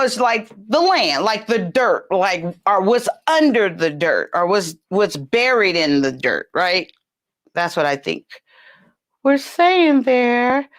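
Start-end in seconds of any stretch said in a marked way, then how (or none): none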